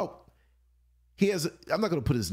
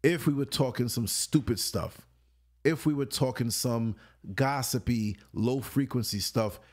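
normal talking voice

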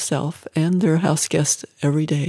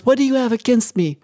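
higher-pitched, accusing outburst; anguished yell; 5 semitones higher, 4 dB louder than usual. A narrator's reading. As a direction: challenge them in anguish, a restrained yell. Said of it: "she yelled".

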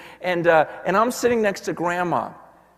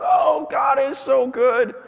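joyful exclaim; 6 semitones higher, 4 dB louder, slower than usual. Retold or imitated. Grandma's joyful exclaim, voiced by pastor.

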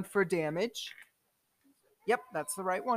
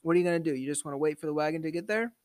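ordinary delivery

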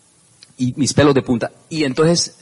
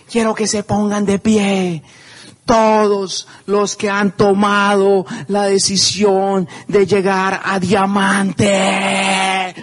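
slow, high, solemn stage call; speaker on stage; 4 semitones higher, much slower than usual. Retold or imitated. Woman on stage calling up new Diamonds, slow and high. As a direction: draw it out like addressing a packed hall. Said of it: "la señora hace esto".

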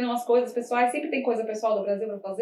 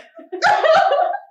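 squeals with laughter; can't hold the laugh back; high loud laugh; 6 dB louder than usual. Real, not acted. Recalling office embarrassment to a friend, high loud laughter. Laughing at her own blunder.

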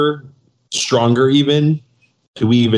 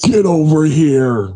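Scorpion voice; 5 semitones higher, slower than usual. Retold or imitated. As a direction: call it out like the Mortal Kombat ninja. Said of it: "he is telling who to get over here".

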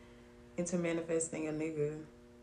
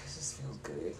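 low breathy whisper; 12 semitones lower, 5 dB quieter, slower than usual. Real, not acted. Confessing her bedroom taste to the mic, whispered. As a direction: hush it like a guilty secret. Confessing what she likes in sex.

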